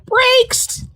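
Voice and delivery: a high, bright exclamation of discovery; sudden delighted realization. Retold or imitated. She tells the hosts her sudden realization, in a high excited exclamation. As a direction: blurt it out like a sudden revelation.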